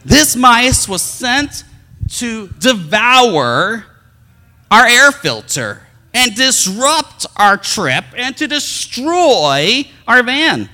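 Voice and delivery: hammed-up pulpit thunder; mock preaching. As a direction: preach it like a mock sermon on evil. Mock preaching about demon mice.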